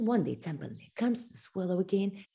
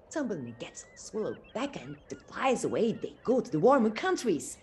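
plain reading voice

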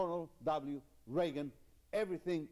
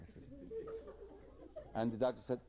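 usual speaking voice

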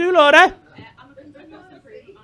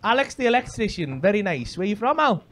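ordinary talk

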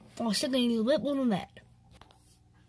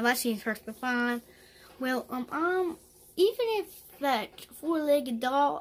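usual speaking voice

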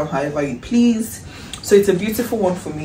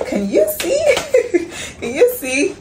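high laughing can you see; giddy laugh; 13 semitones higher than usual. Real, not acted. Vlogger showing viewers the box, laughing giddily. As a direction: laugh through it, delighted.

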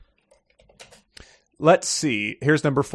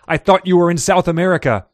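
higher-pitched, taken-aback aide; flustered; 4 semitones higher, 6 dB louder than usual. A narrator's reading. Aide to boss he thought was abroad, flustered. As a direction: blurt it out, caught off guard. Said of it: "Brinkerhoff stammered".

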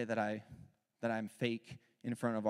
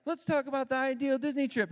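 much higher, mock sweet mom voice; imitating wife; 12 semitones higher, 5 dB louder than usual. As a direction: play Mom suggesting a fun trip. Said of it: "Cruz is playing the role of my wife".

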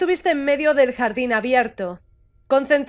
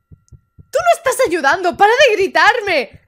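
high, loud complaint, stretched at the end; exasperated protest; 5 semitones higher, 4 dB louder than usual. Acted. Panicking character tells helper they aren't helping, exasperated. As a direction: protest with mounting irritation.

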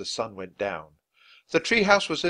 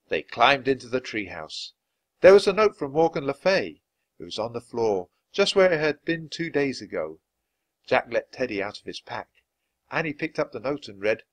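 ordinary delivery

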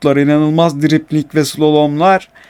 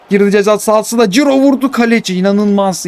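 higher and fast, rising with the shot; excited commentary; 8 semitones higher than usual. Real, not acted. Gamer calling his striker's shot, fast excited commentary. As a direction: call the chance as it happens, quickly. Own shot on goal, live.

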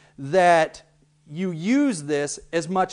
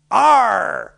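loud pirate growl; 3 semitones higher, 8 dB louder than usual. Shown as a demonstration. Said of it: "it can be".

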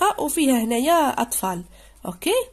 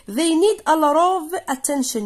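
slow, louder, each word given its weight; slow reading aloud; 5 semitones higher, 4 dB louder, much slower than usual. Shown as a demonstration. Teacher reading the text sentence aloud, slow and louder. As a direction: read the line slowly for the class. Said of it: "reading sentence to class".

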